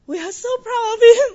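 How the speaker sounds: high, loud burst of emotion; real proud outburst. Real, not acted. Church member testifies about new believers, bursting with pride. Joyful testimony rising into laughter.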